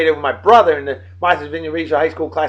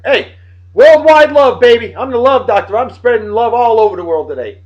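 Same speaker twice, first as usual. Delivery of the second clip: hyped showman; 5 semitones higher, 5 dB louder than usual. Pretend. Hyping himself as love doctor.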